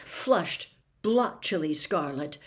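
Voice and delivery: plain reading voice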